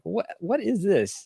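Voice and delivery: high, slow, drawn-out disbelief; baffled reaction. Retold or imitated. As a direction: act out bewilderment at a weird video. Acted reaction to weird videos.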